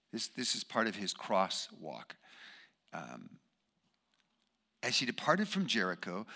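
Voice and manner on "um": ordinary delivery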